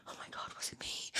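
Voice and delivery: hushed, barely voiced whisper; retold self-doubting girl. Imitating a girl blamed for his fault, anxious whisper. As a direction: whisper it as a dawning worry. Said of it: "and she'd be like".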